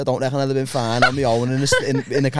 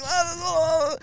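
small, soft, very high whimper; imitated crying; 12 semitones higher, 6 dB quieter, slower than usual. Drunk self whimpers to police on horseback, imitated crying. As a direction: squeak it out softly as a mock sob. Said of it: "Why are you crying?".

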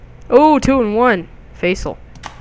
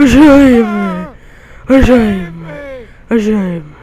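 mock cry for help; 6 dB louder, much slower than usual. Gamer voicing a ragdoll victim, loud mock cry for help. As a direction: call out like a victim in trouble.

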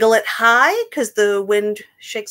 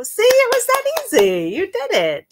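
high praise; 11 semitones higher than usual. Acted. Praising children after song.

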